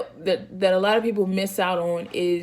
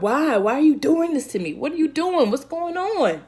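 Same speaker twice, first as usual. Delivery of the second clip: high and louder, rising to a near-wail; crying out at God, much higher and louder; anguished cry to God; 9 semitones higher, 6 dB louder than usual. Retold or imitated. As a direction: build from questioning to a desperate cry. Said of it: "He just asked God".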